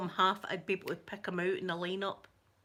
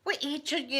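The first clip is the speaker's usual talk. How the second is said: high, loud self-rebuke in her head; scolding herself; 9 semitones higher, 6 dB louder than usual. Retold or imitated. Scolding herself inwardly for staring, high and loud. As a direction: tell yourself off sharply for staring. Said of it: "I'm thinking to myself".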